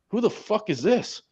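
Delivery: high, startled question; recalling the shock of something under the sandbags. Retold or imitated. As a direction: blurt it out in alarm.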